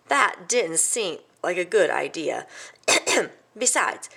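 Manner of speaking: ordinary delivery, nothing marked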